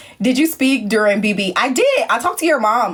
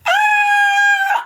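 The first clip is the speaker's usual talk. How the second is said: very high sustained squeal; laughing shriek; 4 dB louder than usual. Real, not acted. Cracking up at own story, high squeal. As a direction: let out a long high "ah" after laughing.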